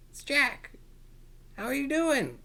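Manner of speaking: high cheerful welcome; excited greeting. Real, not acted. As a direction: greet them like an excited host. Greeting kids on camera.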